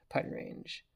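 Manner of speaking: Putting range. whispered to himself. Real, not acted. Player confirming the distance, soft whisper. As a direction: breathe Putting range. under the breath. Answering himself mid-game.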